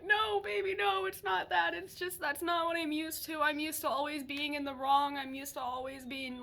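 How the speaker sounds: high-pitched mock-tearful protest; imitated bawling. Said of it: "I just started bawling".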